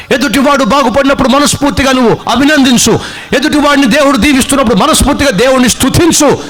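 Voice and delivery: high-pitched, driving exhortation; forceful preaching. Real, not acted. Preacher urging listeners to rejoice for others, impassioned exhortation. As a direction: urge them on, line after line.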